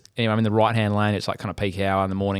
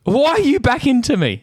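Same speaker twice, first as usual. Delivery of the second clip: mocking the other driver; 5 dB louder than usual. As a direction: mimic the guilty driver in a high, whining tone. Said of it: "He goes".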